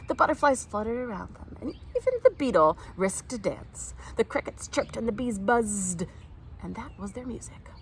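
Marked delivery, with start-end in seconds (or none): none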